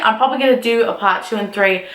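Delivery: ordinary talk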